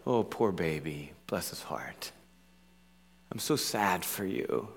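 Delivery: soft, slow mock sympathy; syrupy pity. Preacher imitating how people tend to show sympathy, softly pitying. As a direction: coo in pity, hushed, as a shallow consoler would. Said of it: "we tend to think of, uh, sympathy like".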